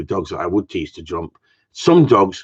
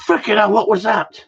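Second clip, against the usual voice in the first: retold startled outburst, pitched way up; startled exclamation; 12 semitones higher than usual. Reacting to wife dropping things in the morning, startled outburst. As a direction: exclaim it high, as if something just crashed. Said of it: "And I go".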